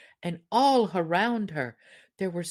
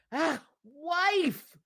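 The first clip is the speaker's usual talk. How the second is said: timid husband; 10 semitones higher than usual. Acted. Frightened husband protests.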